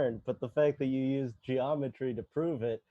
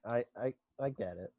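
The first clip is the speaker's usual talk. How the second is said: mock choked up; 2 semitones lower, 11 dB quieter, faster than usual. Mock tearful after the burn.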